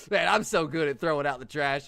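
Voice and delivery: loud cocky brag. Pretend. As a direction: brag it loudly. Bragging about trash.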